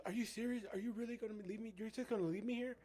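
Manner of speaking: put-on scared little girl. Girl begs the youth pastor not to leave her, imitated high and pleading. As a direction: plead like a frightened kid about to be left behind.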